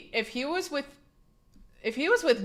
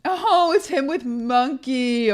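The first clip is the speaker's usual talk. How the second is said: delighted; 4 semitones higher, 6 dB louder, slower than usual. Real, not acted. Delighted at mom's photo.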